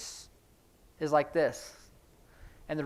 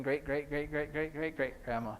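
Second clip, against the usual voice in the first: soft, steady run of greats; quiet monotone chain, sinking at the end; rattled-off repetition; 7 dB quieter than usual. Shown as a demonstration. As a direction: count back through the generations under your breath. Repeating the generations chain, trailing downward.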